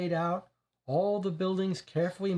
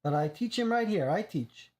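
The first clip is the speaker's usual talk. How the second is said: lightly voiced Italian immigrant accent, proud; a touch of a broken-English Italian accent; 2 semitones higher than usual. Reggio speaks, Italian immigrant accent.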